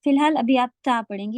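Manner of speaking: ordinary talk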